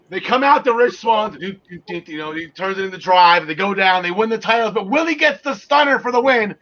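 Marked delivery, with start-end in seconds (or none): none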